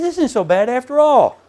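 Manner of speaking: high, loud happy relief; a bright burst of bird contentment; pleased little bird. Acted. Speaker voices the warmed-up bird, in a happy, relieved exclamation. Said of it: "He says".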